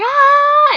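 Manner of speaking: high loud mock scream; imitated fan scream; crowd scream.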